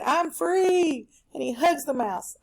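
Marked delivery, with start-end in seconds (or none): 0.0-1.1 higher, excited "I'm free"; the freed lion shouting for joy; lion's joyful cry. Acted. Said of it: "remember the lion said".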